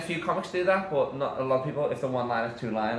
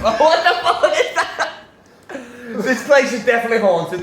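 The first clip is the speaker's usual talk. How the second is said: high, loud startled outburst through laughter; laughing alarm; 10 semitones higher, 9 dB louder, slower than usual. Real, not acted. Host startled by something in the room, laughing in alarm. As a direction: react to a spook, half laughing.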